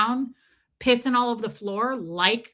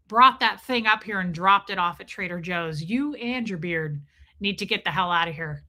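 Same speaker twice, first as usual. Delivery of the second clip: usual speaking voice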